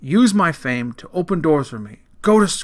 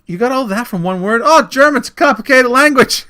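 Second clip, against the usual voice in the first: raised, higher voice for a mock interpreter exchange; acted courtroom exchange; 6 semitones higher, 5 dB louder than usual. Bogus interpreter bluffing the court, in a raised, acted voice. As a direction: act it out loudly, pitched up.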